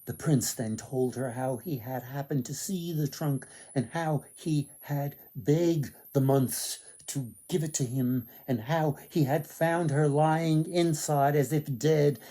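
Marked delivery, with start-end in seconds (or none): none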